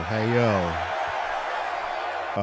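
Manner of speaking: laughing. Retold or imitated. Echoing the alert, laughing.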